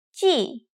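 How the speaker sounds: 鸡 falling sharply and short; 鸡 in the falling fourth tone. Shown as a demonstration. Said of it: "Let's try some more".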